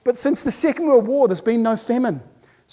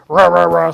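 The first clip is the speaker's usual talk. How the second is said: loud, low "rah-rah" munching; greedy gobbling noise; 4 semitones lower, 9 dB louder than usual. Acted. Speaker imitating humans eating, loud greedy gobbling. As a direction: gobble noisily on one low note. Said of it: "we're eating food".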